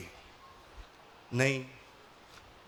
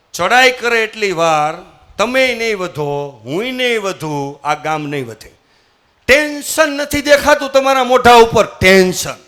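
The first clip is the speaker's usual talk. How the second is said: much louder, urgent warning; alarmed loud scolding; 3 semitones higher, 9 dB louder than usual. Acted. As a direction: shout the danger at the king. Minister scolds the king in alarm. (ગભરાટમાં મોટેથી ઠપકો)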